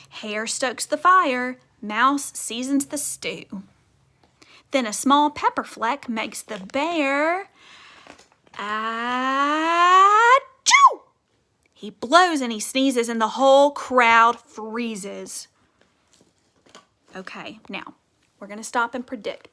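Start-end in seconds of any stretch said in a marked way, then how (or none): 0.1-7.5 put-on lively, louder read-aloud for kids; storybook reading. A narrator's reading. Teacher reads picture book aloud. 8.5-10.3 a big hammed-up achoo; loud mock sneeze. A narrator's reading. Bear sneezes in story. 11.7-15.5 put-on lively, louder read-aloud for kids; storybook reading. A narrator's reading. Teacher reads picture book aloud.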